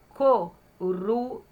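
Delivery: "coruja" in three separate beats, co, ru, ja; syllable by syllable. Shown as a demonstration. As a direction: dictate "coruja" slowly, pausing between syllables. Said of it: "Falei sílaba por sílaba".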